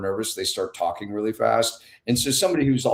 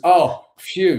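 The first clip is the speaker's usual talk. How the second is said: retold sigh of relief, higher and louder; relieved; 6 semitones higher, 6 dB louder than usual. Young nurse relieved by patient's joke, relieved. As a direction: let out a mock relieved "phew".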